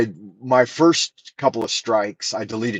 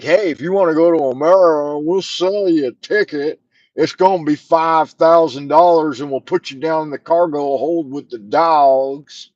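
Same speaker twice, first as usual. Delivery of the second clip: higher, put-on voice of a smug ticket seller; mocking airline voice; 6 semitones higher, 5 dB louder than usual. Mocking the airline selling tickets, smug put-on voice. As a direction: sneer out the offer like a greedy airline. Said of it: "They're like".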